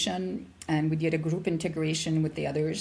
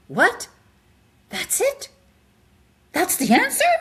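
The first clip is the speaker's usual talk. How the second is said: much higher and louder disbelief; incredulous; 11 semitones higher, 6 dB louder than usual. Retold or imitated. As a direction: burst out in amazed disbelief. Reaching the answer at the mountaintop.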